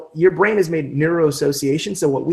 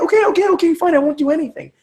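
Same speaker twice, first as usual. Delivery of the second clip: giving up; 13 semitones higher, faster than usual. Retold or imitated. Giving in to the inner voice.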